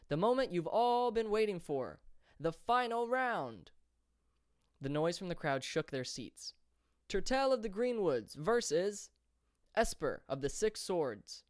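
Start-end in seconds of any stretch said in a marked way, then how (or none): 0.1-3.7 high, loud announcer, slowing right down on the final round; erupting announcer. A narrator's reading. Announcer unveiling the final round to the crowd, high and drawn-out. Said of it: "the announcer erupted once more". 7.1-11.4 high, loud, slow fighter introduction; arena announcer. A narrator's reading. Announcer introducing both fighters to the crowd, slow and loud.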